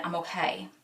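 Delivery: soft retold brave front; holding back tears. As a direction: say it softly, as if keeping tears in. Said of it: "holding back tears".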